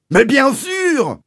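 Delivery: slow, very high yell; indignant shout. Acted. Landowner confirming his accusation, stretched high shout. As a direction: screech it as utterly obvious.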